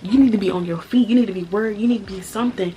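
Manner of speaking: loud, high, furious scolding; angry rant. Real, not acted. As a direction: tear into him for not caring. Angry rant at absent boyfriend.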